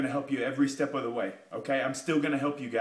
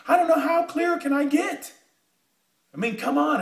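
much higher and louder, near yelling; exasperated shout; 11 semitones higher, 6 dB louder than usual. Real, not acted. To viewers doubting the opportunity, a frustrated shout. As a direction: throw up your hands and yell it.

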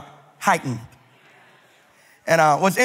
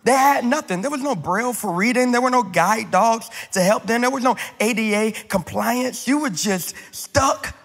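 preaching; 3 semitones higher than usual. Acted. Pastor preaching to the congregation in a rising cadence. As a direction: preach it, building each line.

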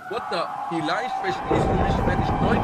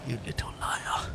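low whispered accusation; mock menace; 9 semitones lower, 8 dB quieter than usual. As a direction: hiss it under your breath, mock-vengeful. Repeats mock threat after scream.

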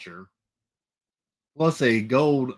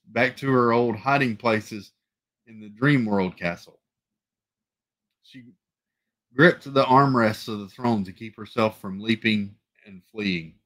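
plain reading voice

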